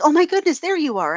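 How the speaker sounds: high, loud burst of delighted recognition; mock-thrilled greeting to a long-lost friend; gushing greeting. Man at the bar greets a supposed old acquaintance, gushing.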